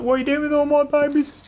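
high squeaky mum dog voice; voicing the mother dog. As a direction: voice the worried mother rat. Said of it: "She's like".

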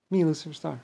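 ordinary talk, nothing marked

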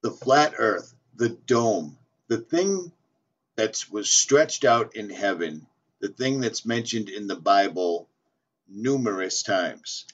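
usual speaking voice

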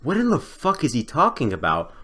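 normal talking voice

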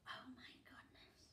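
hushed shocked whisper. Acted. Reader to children after the plot, whispered in alarm. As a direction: breathe it out in alarm.

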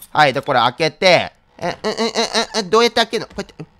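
usual speaking voice

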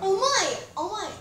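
loud, high shocked gasp. Real, not acted. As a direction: blurt it out in shock. Shocked by a scary creation.

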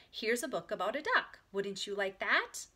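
ordinary delivery, nothing marked

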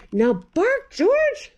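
a high sing-song call of George; coaxing mother voice; urging mother. Acted. Mother dog coaxing George, high call.

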